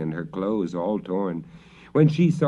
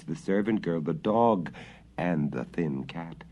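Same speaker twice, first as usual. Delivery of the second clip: usual speaking voice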